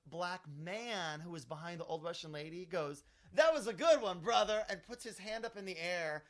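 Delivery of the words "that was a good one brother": loud, high imitated congratulation; hearty cheer. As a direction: cheer the joke like an onlooker, booming. Man cheers, offers high five.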